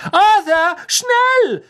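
very high, loud yell; urgent shout. A narrator's reading. Wife calling as the train departs, urgent shout. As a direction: scream for him to hurry. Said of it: "rief Misses Weasley".

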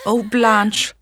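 ordinary delivery, nothing marked

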